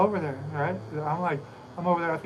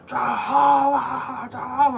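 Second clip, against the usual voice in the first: slow, high, louder drawn-out yell; 10 semitones higher, 7 dB louder, much slower than usual. Retold or imitated. Chasing the two bikers down, yelling the name louder and longer. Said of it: "I start yelling out louder".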